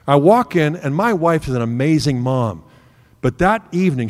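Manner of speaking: ordinary talk, nothing marked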